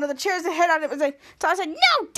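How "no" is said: very high-pitched mock protest; acted cry of no. Toddler in the chair fight, high-pitched mock cry. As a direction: cry out in cartoon alarm.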